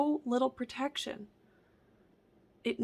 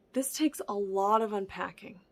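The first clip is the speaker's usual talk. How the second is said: usual speaking voice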